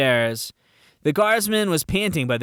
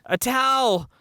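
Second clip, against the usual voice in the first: gasping cry; 10 semitones higher than usual. A narrator's reading. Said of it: "the guardsman gasped".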